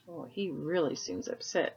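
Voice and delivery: plain reading voice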